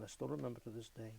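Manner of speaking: usual speaking voice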